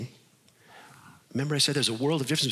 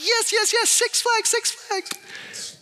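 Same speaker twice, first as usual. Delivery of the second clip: put-on squealing high kid voice, much higher; excited kids; slower than usual. Kids cheering about the Six Flags trip, squealing high. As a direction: bounce up high like thrilled children. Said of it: "And the kids are happy. They're like".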